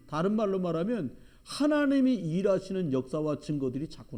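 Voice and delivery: loud, high preaching climax; fiery preaching. Acted. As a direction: build up to the blessing. Preacher builds to the blessing.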